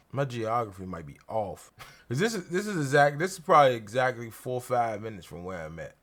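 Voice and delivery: ordinary talk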